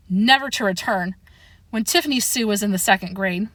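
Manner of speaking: ordinary delivery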